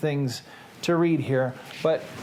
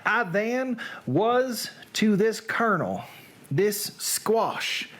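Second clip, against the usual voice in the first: put-on Southern accent; 3 semitones higher than usual. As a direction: recite it theatrically in a Southern accent. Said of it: "read now in a Southern accent".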